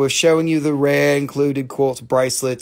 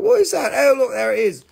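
mock self; 10 semitones higher, 5 dB louder than usual.

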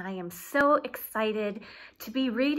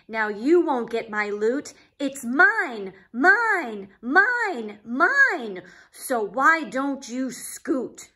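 shouting; 2 semitones higher, 6 dB louder, much slower than usual. Acted. Pig gloating at Trevor over his loot, loud shout. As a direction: yell it down, greedy and smug. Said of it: "shouted Pig".

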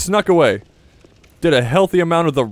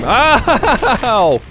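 hit hard crying out; 11 semitones higher, 7 dB louder than usual. Real, not acted. Laughs then hit hard.

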